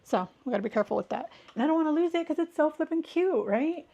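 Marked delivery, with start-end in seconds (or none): 1.5-3.9 high, squealy gush over a cute charm; gushing delight. Real, not acted. Vlogger gushes to viewers about her bow charm, high and delighted. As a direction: coo over something adorable.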